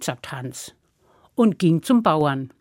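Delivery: plain reading voice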